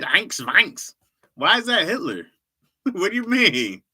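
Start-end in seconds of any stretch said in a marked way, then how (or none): 0.0-1.0 mild imitation of his mock German accent. Defending his German attempt, he repeats it in a mild German accent. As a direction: repeat the fake German words lightly. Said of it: "I said".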